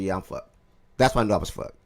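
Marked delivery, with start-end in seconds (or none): 0.0-0.5 resigned. Retold or imitated. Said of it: "I said in the Discord".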